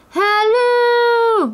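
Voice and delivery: high, long-held holler; loud, drawn-out calling; shouted call. A narrator's reading. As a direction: shout it out with a stretched final note. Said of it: "leaned down and shouted".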